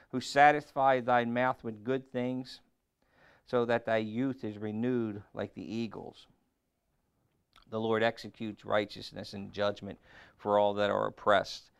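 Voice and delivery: loud, measured psalm reading; scripture reading. Acted. Preacher reading the psalm to the congregation, loud and measured. Reads further psalm verses.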